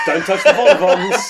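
laughing announcement. Pretend.

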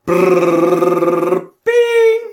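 jingle noise. Acted. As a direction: ring the segment jingle. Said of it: "You're still too quick".

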